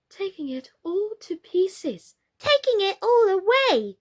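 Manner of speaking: very high, frightened wail; alarmed doll. A narrator's reading. A doll wailing as the house is stripped, very high.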